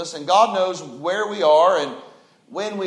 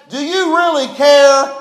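disciples' plea; 9 semitones higher, 10 dB louder than usual. Retold or imitated. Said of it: "You know what they said?".

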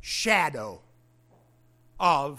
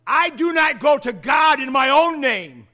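loud, raised preaching line, punching each word; emphatic preaching; 6 semitones higher, 11 dB louder than usual. Real, not acted. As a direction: drive it home to the congregation. Preacher builds emphatic claim.

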